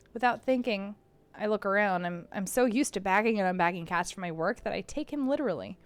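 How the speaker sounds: ordinary talk, nothing marked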